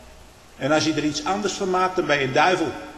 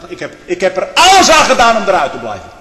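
louder burst of protest, falling away at the end; sudden emphatic outburst; loud exclamation; 4 semitones higher, 9 dB louder than usual. Real, not acted. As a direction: exclaim it forcefully from the pulpit. Preacher exclaims about his calling.

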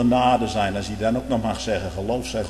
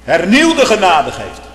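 loud, higher fervent preaching that builds to a shout; preaching at full force; 7 semitones higher, 10 dB louder than usual. Real, not acted. Preacher exhorting his congregation, preaching at full force. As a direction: thunder it from the pulpit.

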